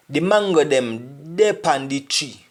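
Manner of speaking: slow, spaced-out Jamaican Patois; slowed for learners. Shown as a demonstration. Teacher helping learners pick out Patois words, slowed down. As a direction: say "dey pan the tree" slowly, word by word. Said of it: "I'm trying to speak slowly".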